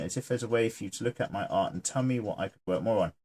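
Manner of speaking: normal talking voice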